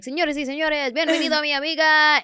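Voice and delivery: high, loud Spanish emcee intro; announcer in Spanish. Acted. Introducing herself at a heritage festival, hammed-up Spanish announcer. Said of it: "And then I do a whole".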